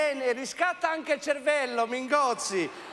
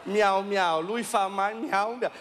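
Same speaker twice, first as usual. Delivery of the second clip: low mocking; 6 semitones lower, slower than usual.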